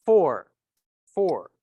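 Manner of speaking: loud, falling teacher model; loud drill word; modelling for repetition. Shown as a demonstration. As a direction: say it out firmly for students to repeat. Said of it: "Repitan conmigo".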